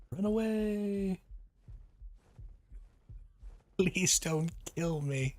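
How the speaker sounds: high, slow panicked pleading; terrified horror victim. Pretend. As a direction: squeal it out like prey fleeing. Spotting player on a wyvern.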